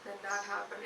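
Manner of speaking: soft, droning lecturer; dull, monotonous lecture voice; low monotone. Shown as a demonstration. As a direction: drone on without any lift. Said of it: "in a very low and monotonous tone".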